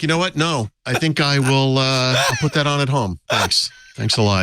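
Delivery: fake-polite brush-off; sarcastic thanks. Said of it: "And I'm like".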